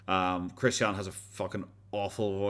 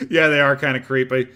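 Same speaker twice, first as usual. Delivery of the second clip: ordinary talk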